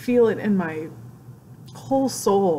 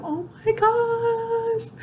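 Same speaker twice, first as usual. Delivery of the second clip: drawn-out, very high squeal of delight; overjoyed squeal; much slower than usual. Real, not acted. Fan telling viewers about a hero's reply, overjoyed squeal. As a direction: squeal it slowly, high with amazement.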